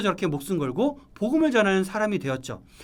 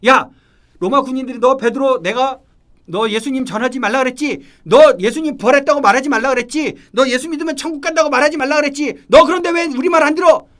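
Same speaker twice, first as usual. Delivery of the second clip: acted interrogation, building to a high shout; angry soldier scolding; 6 semitones higher, 10 dB louder than usual. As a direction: berate a prisoner, louder with each accusation. 로마 군인들이 베드로를 다그치는 장면. (점점 언성을 높여 호통치는 군인 연기)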